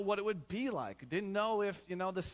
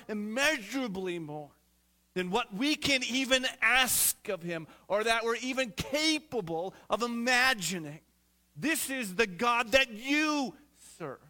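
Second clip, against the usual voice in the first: louder, rising preacher's voice; full-force preaching; 5 semitones higher, 6 dB louder than usual. Acted. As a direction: drive it home to the congregation.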